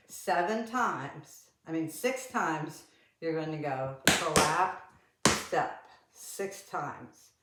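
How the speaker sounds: stretched-out explanation, words drawn out; slow and deliberate. Shown as a demonstration. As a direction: spell it out slowly. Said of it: "that takes some thinking".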